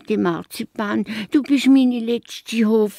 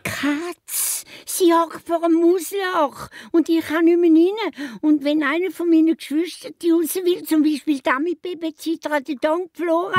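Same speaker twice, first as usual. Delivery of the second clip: anxious rush; 9 semitones higher than usual. Acted. Mouse pours out her worry.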